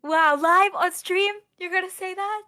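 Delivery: high, loud mock outrage; mock shocked. Real, not acted. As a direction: gasp in playful disbelief. Teasing cohost after joke.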